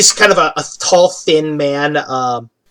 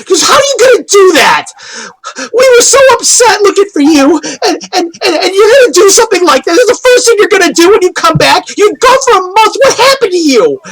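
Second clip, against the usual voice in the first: high, tearful, rushing tirade; upset scolding; 13 semitones higher than usual. Acted. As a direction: rail at the teacher as a distraught girl. Acted girl scolding her absent teacher.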